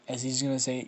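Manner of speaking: ordinary talk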